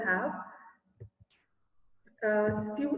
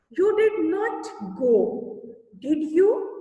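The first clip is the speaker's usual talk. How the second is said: slow, loud and higher, word by word; slow dictation; 4 semitones higher, 5 dB louder, slower than usual. Shown as a demonstration. Teacher dictating a sentence to pupils, slow and loud. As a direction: spell it out for the class to write.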